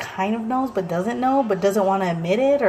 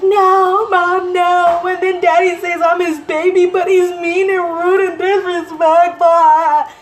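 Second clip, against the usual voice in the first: put-on much higher, louder whiny child voice; whiny kid complaint; 10 semitones higher, 8 dB louder, slower than usual. Son complaining to mom about his rude dad, in a high whine. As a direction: whine it like a sulking boy.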